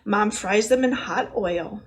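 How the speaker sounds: ordinary delivery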